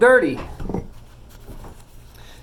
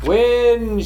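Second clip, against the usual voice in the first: calling out loudly, higher; shouted; 5 semitones higher, 4 dB louder than usual. A narrator's reading. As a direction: shout it up to the wind. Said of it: "shouted the mouse".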